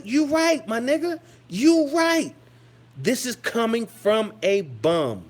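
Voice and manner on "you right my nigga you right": much higher, laughing assent; higher delighted "you right"; gleeful agreement. Real, not acted. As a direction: crow it out with glee, pitched high. Gleeful put in my place.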